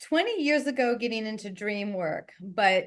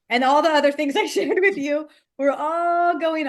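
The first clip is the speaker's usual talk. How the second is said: louder, higher, amused through a laugh; 7 semitones higher, 7 dB louder than usual. Real, not acted. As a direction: let a real laugh break into the words. Amused at her own busy past.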